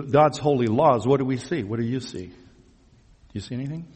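ordinary delivery

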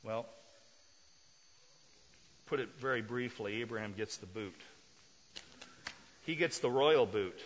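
ordinary delivery

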